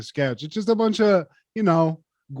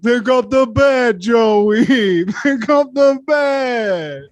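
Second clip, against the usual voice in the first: higher, slowing demand; mock De Niro boxer; 5 semitones higher, 4 dB louder, slower than usual. Boxer goading brother Joey, high drawn-out demand. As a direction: urge him on, stretching the last words. Quoting Ridiculous Bull sketch.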